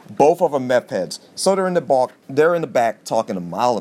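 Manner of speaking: ordinary delivery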